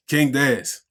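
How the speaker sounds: usual speaking voice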